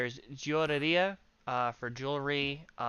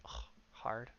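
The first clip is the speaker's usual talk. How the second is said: whispered; 4 semitones lower, 15 dB quieter, slower than usual. Real, not acted. Groaning about hard pronunciation.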